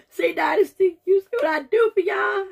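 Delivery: high, loud, laughing appeal; loud laughing plea to the audience; laughing outburst. Real, not acted.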